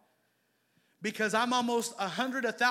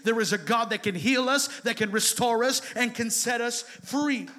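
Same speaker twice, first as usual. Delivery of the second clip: ordinary talk, nothing marked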